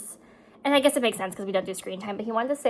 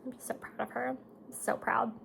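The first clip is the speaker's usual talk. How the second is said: whispered, softer through tears; choked up; 8 dB quieter than usual. Real, not acted. Proud mom holding back tears, choked whisper. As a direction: barely get it out, choked. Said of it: "I'm already gonna cry".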